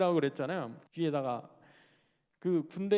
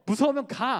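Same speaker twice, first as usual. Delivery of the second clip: loud, high acted order; pressing, intimidating; 7 semitones higher, 8 dB louder than usual. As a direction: scare them into leaving. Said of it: "다그쳐서". (겁주며 큰 소리로 다그치듯)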